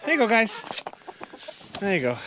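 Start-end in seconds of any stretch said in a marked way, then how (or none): 0.1-0.6 cheery call. Real, not acted. Feeding the roosters.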